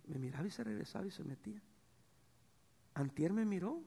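ordinary talk, nothing marked

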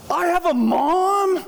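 very high, slow astonished question; so excited. Retold or imitated. Groggy son hearing he has a mom, so excited. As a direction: stretch it out in hammed-up wonder. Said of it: "he's so excited".

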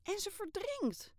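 very high, slow alarmed cry; shouted. Acted. Girl crying out that the island is drowning, high and slow. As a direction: call out the danger. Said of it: "schreeuwde ik".

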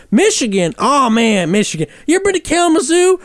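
high, fast excited Southern hillbilly. Retold or imitated. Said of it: "Southern hillbilly caricature".

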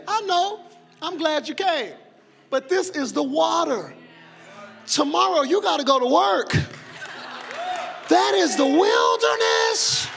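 high, loud preacher's retort building to a shout; full-force preaching. Acted. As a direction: drive each point home, peaking in a long cry.

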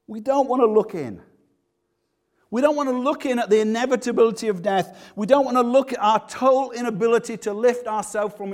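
high, loud, driving sermon voice; rising preacher's passion; fervent preaching. Acted. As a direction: press the congregation hard. Preaching at full force.

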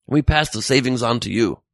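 ordinary delivery

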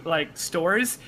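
ordinary talk, nothing marked

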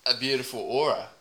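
a hint of a laconic Aussie bloke accent; deadpan Aussie accent, mostly his own voice; put-on Aussie accent. Podcaster riffing about a stranger in a light put-on Aussie accent. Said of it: "I've already got my accent".

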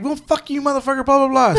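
high-pitched mock rant; retold cussing customer. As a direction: mimic an irate customer up high, without shouting. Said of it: "She started cussing at me".

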